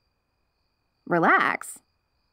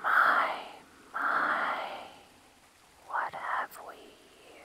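softer, breathy ASMR whisper; close ear whispering; 12 dB quieter, much slower than usual. Shown as a demonstration. Wolf girl tries ASMR whispering on the human, whispered. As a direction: whisper right into the ear, slow. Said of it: "let me try".

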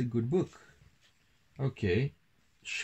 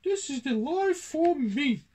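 very high little-mouse voice; Pippin's squeak; 4 dB louder than usual. A narrator's reading. Country mouse snug in his own bed, high content squeak. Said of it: "he said".

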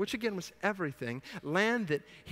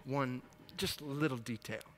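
sly aside; 3 semitones lower, 11 dB quieter than usual. Retold or imitated. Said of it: "He says".

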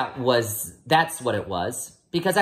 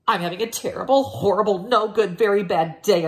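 put-on higher, louder, a kid announcing his misery; whiny complaint; 7 semitones higher, 4 dB louder than usual. Boy complaining loudly to his family about his day. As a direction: wail it out to everyone. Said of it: "I told everybody".

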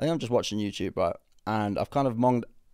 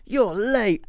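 a high, teasing "You're late" pushed right into her face; mock cheeky greeting; 10 semitones higher, 5 dB louder than usual. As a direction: jerk forward and tease. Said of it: "right in her face".

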